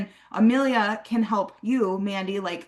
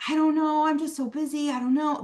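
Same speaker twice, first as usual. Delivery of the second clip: hesitant prospect; 6 semitones higher than usual. Retold or imitated. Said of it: "Most people are like".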